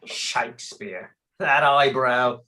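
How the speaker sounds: slow, low, dismissive sneer; scornful. Acted. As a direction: sneer at the name. Scornful about Shakespeare.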